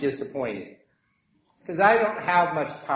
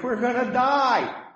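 higher-pitched mock alarm; worried cry; 7 semitones higher than usual. Disciples in the rocking boat, worried mock cry. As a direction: imitate a worried cry, falling on the last word. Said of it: "They're worried".

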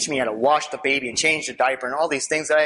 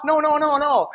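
mock panicked refusal; 7 semitones higher than usual.

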